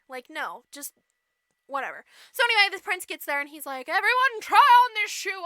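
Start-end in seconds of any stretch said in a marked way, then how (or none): 3.9-5.4 loud, higher announcing voice; prince's proclamation. Retold or imitated. Prince addresses all the maidens, loudly proclaiming. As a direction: proclaim it to the whole household.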